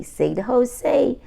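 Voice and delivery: plain reading voice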